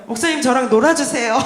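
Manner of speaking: loud, flustered plea; flustered blurt. Retold or imitated. New believer asks the pastor to spend time with them, flustered. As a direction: blurt it out loudly in sudden panic. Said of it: "순간 당황해서".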